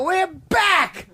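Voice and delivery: rock star shout. Retold or imitated. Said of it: "and then I go".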